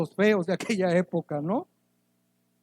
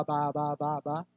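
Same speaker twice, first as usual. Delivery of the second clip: put-on baby babble; 5 semitones lower, 6 dB quieter than usual. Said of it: "hasta de ahí viene ba".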